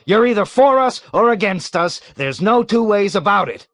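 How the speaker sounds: high, loud, hammering for; emphatic shout. A narrator's reading. Colonel shouting his ultimatum at the captain. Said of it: "cried emphatically".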